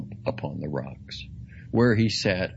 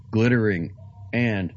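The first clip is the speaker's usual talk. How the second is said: plain reading voice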